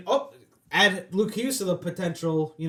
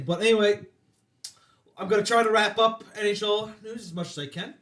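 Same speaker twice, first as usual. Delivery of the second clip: ordinary delivery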